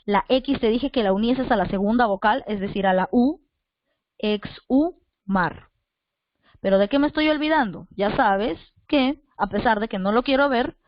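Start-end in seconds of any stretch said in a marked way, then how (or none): 4.2-5.7 exhumar very slowly as ex, hu, mar; ex... hu... mar with clear gaps; syllable by syllable. Shown as a demonstration. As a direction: break exhumar into three spaced syllables. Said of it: "para separar exhumar sería así".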